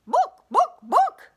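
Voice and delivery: high, loud clucks of book; excited hen clucking. Acted. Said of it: "clucked the hen quite clearly".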